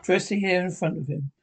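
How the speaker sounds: usual speaking voice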